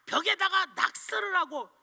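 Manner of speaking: put-on high, loud angry shout at the boy; furious father yelling. Father scolds his son for drawing on the wall, shouting. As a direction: blow up at your son. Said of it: "소리를 빠락 치는 거예요".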